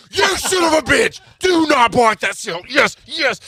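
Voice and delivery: frantic shout. Retold or imitated. Buyer yelling at his bank not to block the sale, frantic shout. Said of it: "I'm like".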